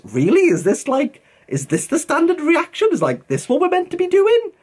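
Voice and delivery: high-pitched acted disbelief; incredulous questioning. Bookish viewer questioning online norms, incredulous. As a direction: climb high, querying it in disbelief.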